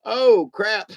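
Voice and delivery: mock dismay at misreading; sudden realization. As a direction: catch your own mistake out loud. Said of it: "And I was like".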